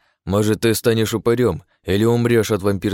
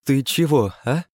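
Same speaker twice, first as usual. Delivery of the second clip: higher startled question; frightened; 6 semitones higher than usual. A narrator's reading. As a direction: ask it scared and unsure. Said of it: "испуганно спросила она".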